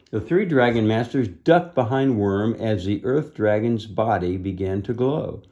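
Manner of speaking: usual speaking voice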